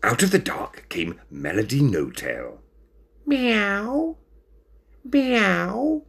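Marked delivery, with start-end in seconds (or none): 3.2-6.0 very high, drawn-out meow; long squeaky meows; calling cat. A narrator's reading. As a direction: call out like a cat. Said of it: "she called".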